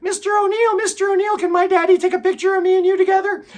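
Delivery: put-on high-pitched, pleading child's voice; excited kid begging for a photo; little kid. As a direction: put on a ten-year-old's voice, way up high. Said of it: "there was this little kid".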